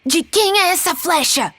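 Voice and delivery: high-pitched, indignant question; angry demand. A narrator's reading. Peter confronts the boys about the arrow, angry demand. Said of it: "Peter ficou bravo e perguntou".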